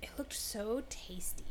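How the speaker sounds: slow hushed confession; whisper. Real, not acted. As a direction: whisper it like a guilty secret. Confessing organs looked tasty.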